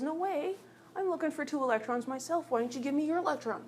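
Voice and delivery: high-pitched, refusing little atom. Acted. Oxygen argues with hydrogen over electrons, high put-on atom voice. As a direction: argue back in a squeaky cartoon atom voice. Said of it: "my atom voice again".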